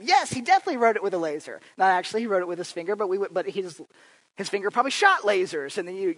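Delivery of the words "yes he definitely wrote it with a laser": loud, high, fast, mock-serious agreement; mock certainty. Speaker joking with Johnny at three a.m. in mock certainty. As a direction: play along deadpan-certain, tongue in cheek. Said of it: "to which I said".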